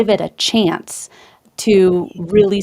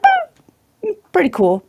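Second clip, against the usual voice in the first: put-on light Australian accent, drawn-out high "Well"; a breezy Aussie take on "pretty cool"; 10 semitones higher, slower than usual. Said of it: "you did such a great impersonation, Australian accent".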